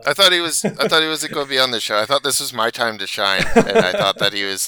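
loud, high-pitched playful protest; hammed-up aggrieved outburst, easing down in pitch; mock indignation. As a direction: complain loudly as if upstaged, joking. Playful protest at absent co-host's email.

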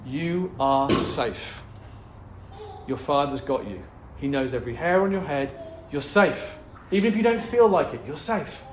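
gently telling himself he's safe; reassuring self-talk. Real, not acted. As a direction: speak comfort to yourself. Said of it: "I am learning to speak it".

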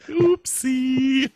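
very high, sing-song squeaky oopsie; mock innocent oopsie. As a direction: squeak it out like a guilty child. Mock supernatural killer joke.

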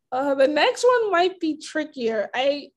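usual speaking voice

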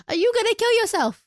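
talking to the dog. Real, not acted.

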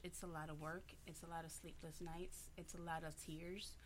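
quiet, teary voice; fighting back tears; crying. Real, not acted. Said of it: "why are you crying?".

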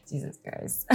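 a shocked aside under the breath; whispered disbelief; stunned whisper. Real, not acted. Reacting to steamy passage.

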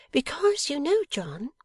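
very high-pitched coy voice; pouting wife. A narrator's reading. Wife explains herself to husband, pouting. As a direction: pout it, pitched right up. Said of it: "pouting a little more".

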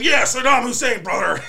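mock Hogan, breaking into laughter; Hulk Hogan. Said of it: "he's like".

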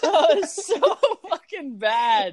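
high, slow laughing voice; cracking up, pitch jumping high. Real, not acted. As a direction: wheeze the words through real laughter. Laughing hard.

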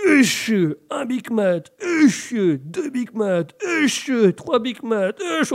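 hammed-up retching between words, high and loud; dry heaving. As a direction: gag loudly between each Big Mac. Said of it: "Oh, je vais vomir".

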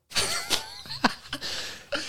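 tertawa lepas nyaring. Real, not acted. Laughing at own bad luck story.

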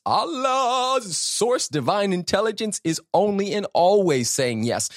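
high, soaring preacher voice; fervent preaching. Acted. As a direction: lift it up like a revival sermon. Preaching rises in fervor.

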